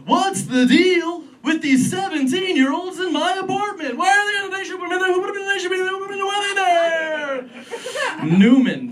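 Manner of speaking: very high, strained comic whine running into babble; bad Jerry Seinfeld. Comedian showing the crowd a deliberately bad impression, high whiny caricature. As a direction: push a hammed-up observational-comic voice way up high. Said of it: "Here's my bad impression of Jerry Seinfeld".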